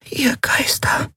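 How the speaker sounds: high, soft, unvoiced gasp of awe; a tiny fairy whispering in shock; breathed whisper. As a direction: breathe the words out in astonishment. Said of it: "hauchte Nelen".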